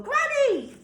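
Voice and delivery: high, loud exclaimed "Granny!"; surprised exclamation. Shown as a demonstration. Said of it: "read it in a louder voice or a surprised".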